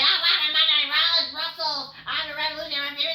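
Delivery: high, loud mock wrestler promo; hammed-up Seth Rollins impression, high and loud. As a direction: ham up the promo, shouting. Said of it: "he's like".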